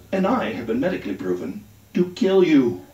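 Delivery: usual speaking voice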